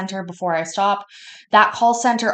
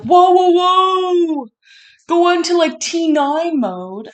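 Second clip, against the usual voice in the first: high, loud and very slow whoa; slow boss calm-down; 11 semitones higher, 10 dB louder, much slower than usual. Retold or imitated. Boss Andy stopping her quitting, loud slow whoa. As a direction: stretch each whoa to stop her.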